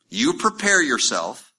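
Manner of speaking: ordinary talk, nothing marked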